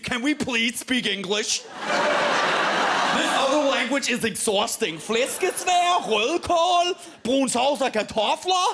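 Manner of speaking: loud, slow exhausted complaining; hammed-up weary Dane. Danish family at Christmas moaning, loud and slow. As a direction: whine about speaking Danish. Danes groaning over their language.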